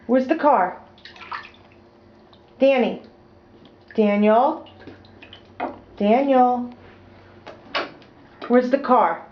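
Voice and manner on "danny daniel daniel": ordinary talk, nothing marked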